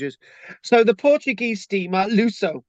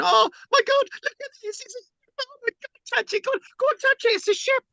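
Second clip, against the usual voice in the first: very high squeaky gasping voices; mock awestruck crowd. Lecturer mimics beachgoers at the wreck, very high and squeaky. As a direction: gasp like a crowd daring each other to touch. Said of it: "They're all probably going".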